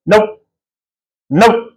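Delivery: two short rising nopes, no extra volume; firm warning tone. Shown as a demonstration. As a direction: warn the dog off without yelling. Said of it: "What I want you to do is ... something like that".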